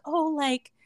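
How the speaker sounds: high-pitched imitation of calling out; mock yell. Girl telling Yijin she got into the school, mock yell. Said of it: "she was yelling out the window".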